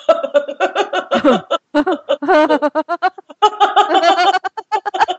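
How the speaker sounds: high, long stretched-out laugh; a long-necked giraffe laughing. Shown as a demonstration. Laughter teacher shows the class a giraffe laugh. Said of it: "it might sound like this".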